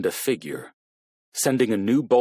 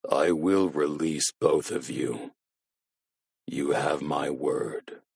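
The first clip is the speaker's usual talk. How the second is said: low, slow, soft machine voice; cold voice-changer promise; low robotic monotone; 6 semitones lower, 4 dB quieter, slower than usual. A narrator's reading. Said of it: "robotic, monotone voice".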